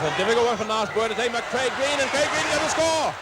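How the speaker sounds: rising fast to a near-shout as the try looms; excited call. Real, not acted. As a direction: call it like he's about to score. Try nearly scored, live call.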